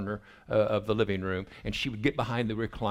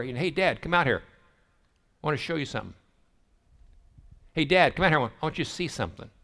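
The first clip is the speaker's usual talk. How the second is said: called out louder and higher; hollering son; 5 semitones higher, 4 dB louder, faster than usual. Retold or imitated. Teen son calling dad outside, hollering. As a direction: holler for your dad from the door. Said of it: "he would holler".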